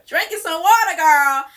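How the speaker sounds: suddenly loud, insistent reminder; loud alarm call. Pretend. Alarm reminder shouting at her to drink water, loud. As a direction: blast it out like an alarm going off. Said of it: "Set an alarm. Say".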